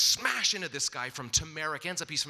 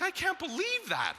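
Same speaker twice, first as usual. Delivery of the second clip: disbelief; 13 semitones higher than usual. Retold or imitated. Amazed at wife's bank story.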